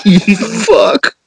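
cursing while cracking up; laughing, squeaky high swearing. Real, not acted. Laughing after bad joke.

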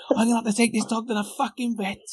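high, fast frantic voice; wife panicking about the vet, mock high and fast. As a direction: rattle it off in a squeaky panic. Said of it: "She said".

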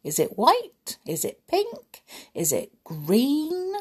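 put-on rising, teasing guesses to the children; playful quiz questions. Shown as a demonstration. Storyteller quizzing children about snow colour, playful rising questions. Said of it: "tell me, what colour is snow".

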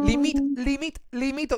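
high glitching limit; mock skipping playback. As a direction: mimic the track stuttering.

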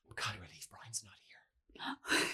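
slow mock whisper of grieving sisters. Retelling sisters at the deathbed, slow mock whisper. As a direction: breathe it out like a hushed mourner.

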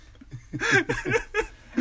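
a burst of high laughter; laughing. Real, not acted. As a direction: laugh out at your own joke. Laughs at his own name joke.

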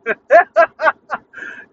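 loud falling belly laugh; laughing at grown men who stink all day, loud laugh. Real, not acted. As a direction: laugh out loud and let it fall away. Laughs at the smell story.

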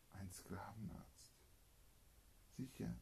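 hushed secret whisper; voiceless conspiratorial whisper. Acted. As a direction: breathe it like a secret. Secretive aside in the story.

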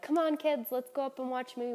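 put-on rally the kids with a bright, lifted voice; mock-cheery mom rounding up kids; upbeat coaxing. Rallying kids in a retold scene.

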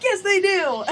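high, loud retort through a laugh; imitating dad's loud, high insistence while cracking up; insistent dad, laughing. Dad insisting again to the receptionist, loud high mock retort with a laugh.

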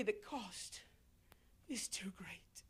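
low, soft whisper of a man giving up; hushed whisper. Acted. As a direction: whisper it as the one who walks away. Voicing the young man refusing.

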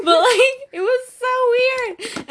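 high, slow laughing words; laughing so hard the words crack. Real, not acted. Laughing hard while retelling her strange dream.

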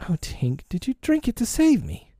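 soft start climbing high; dismayed question. A narrator's reading. Peter realising the fairy's sacrifice, dismayed high question. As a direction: begin hushed, then lift high in disbelief.

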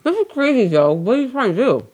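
high, exasperated disbelief; what are you even doing. Real, not acted. Exasperated at partner's phone.

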